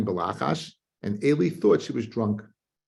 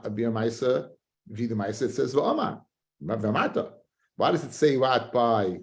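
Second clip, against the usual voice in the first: ordinary delivery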